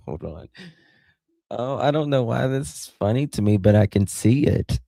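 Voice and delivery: breaking into laughter between words; can barely get it out for giggling; laughing hard. Real, not acted. Streamer cracking up at a viewer's crane photo, laughing.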